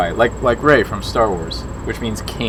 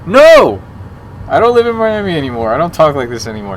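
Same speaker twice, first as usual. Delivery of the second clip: high, shouted refusal; loud protest; 7 semitones higher, 10 dB louder than usual. Real, not acted. Streamer refusing to keep up the accent, loud high protest. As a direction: burst out loudly in indignant protest.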